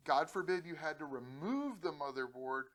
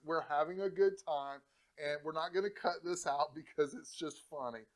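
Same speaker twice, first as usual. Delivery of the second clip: usual speaking voice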